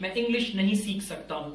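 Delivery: ordinary talk, nothing marked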